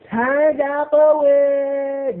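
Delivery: high, very slow drawn-out mocking voice; mock ratchet voice. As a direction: stretch it out high and loud like a lazy coworker. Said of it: "they be like".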